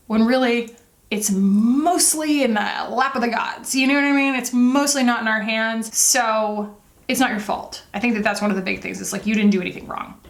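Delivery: normal talking voice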